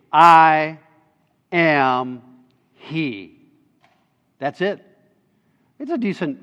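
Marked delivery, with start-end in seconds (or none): 0.1-3.4 "I", "am", "He" each set apart by pauses; emphatic. Shown as a demonstration. Preacher revealing the literal Hebrew to the congregation, weighty and emphatic.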